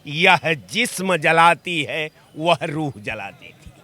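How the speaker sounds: slow, drawn-out couplet delivery; poetry recitation. Acted. Panelist opens his answer with a couplet, slow poetic recitation. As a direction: recite it slowly, lingering on each phrase.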